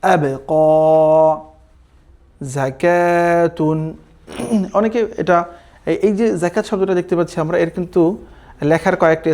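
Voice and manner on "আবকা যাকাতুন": each Arabic word spaced out, slow and clear; measured recitation, one word at a time; slow Quran reading demo. Shown as a demonstration. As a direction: read it out slowly for learners to repeat. Reads Arabic words slowly, syllable by syllable.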